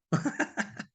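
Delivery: high breathy burst of laughter. Real, not acted. Vlogger laughing at the idea of a scammer confessing, high laugh. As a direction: laugh at the absurdity.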